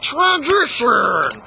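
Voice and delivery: long, drawn-out mock announcement; announced transition. Vlogger switching topics, showy drawn-out announcer call. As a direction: stretch the word out slowly and showily. Calls out a segment change.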